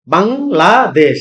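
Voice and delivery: Bangladesh slowly in three separate beats; syllable by syllable. Shown as a demonstration. Teacher counting three syllables, Bangladesh split bang, la, desh. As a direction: pause after bang and after la in Bangladesh. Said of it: "syllable তিনটা".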